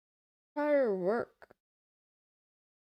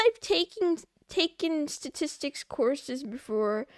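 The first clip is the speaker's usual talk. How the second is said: ordinary talk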